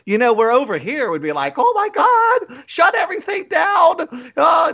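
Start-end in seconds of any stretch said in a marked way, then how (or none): 1.6-4.7 high-pitched panicked wail; hammed-up hysterical cry, voice shooting way up; mock hysterics. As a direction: shriek it like an overblown public panic. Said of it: "in hysterics".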